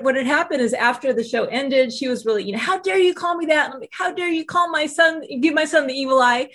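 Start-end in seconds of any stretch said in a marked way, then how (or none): 2.6-3.7 indignant. Retold or imitated. Two moms arguing at show. 3.9-6.5 mother defending her son, mock furious; indignant mock outrage. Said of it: "we were going at it".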